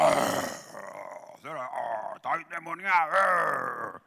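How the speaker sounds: imitating a demon-possessed man, croaking; hammed-up possessed groans; mock possessed voice. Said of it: "cambiaban la voz". (voz de endemoniado imitada)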